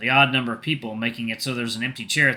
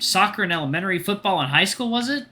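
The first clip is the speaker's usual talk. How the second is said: high-pitched mom voice, chatty; much higher, approving motherly voice; mother, praising; 8 semitones higher, 3 dB louder than usual. Acted. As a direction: play the mom talking up a guest.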